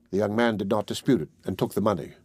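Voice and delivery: usual speaking voice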